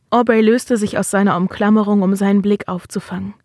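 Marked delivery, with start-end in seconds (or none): none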